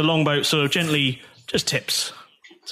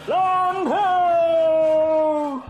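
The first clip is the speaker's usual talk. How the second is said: distant shout. Acted. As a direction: cry it out across the ship. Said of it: "the cry of".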